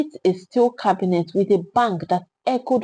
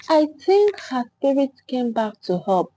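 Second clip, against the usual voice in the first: plain reading voice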